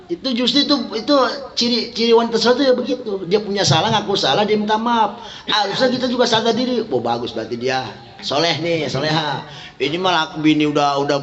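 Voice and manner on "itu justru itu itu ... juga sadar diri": raised, fervent preacher voice; impassioned preaching. Acted. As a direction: preach it up high to the congregation. Ceramah dengan suara tinggi. (ceramah berapi-api dengan nada tinggi)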